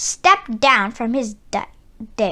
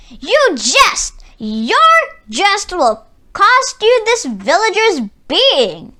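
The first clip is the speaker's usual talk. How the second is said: loud, high, raging roar; tyrant roaring; 7 semitones higher, 5 dB louder, slower than usual. A narrator's reading. Tyrant threatens the wise man for his jest, loud roar. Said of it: "the tyrant roared".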